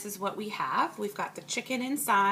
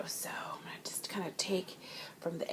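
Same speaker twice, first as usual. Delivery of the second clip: whispering; 11 dB quieter than usual. Real, not acted. Taking a bite from the dish.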